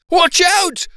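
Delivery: high, loud shrieked warning; terrified dentist shrieking as he climbs the wall. A narrator's reading. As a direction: scream the warning in panic. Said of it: "the dentist shrieked".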